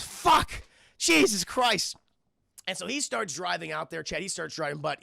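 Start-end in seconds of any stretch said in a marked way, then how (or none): 0.0-2.0 exasperated groan. Retold or imitated.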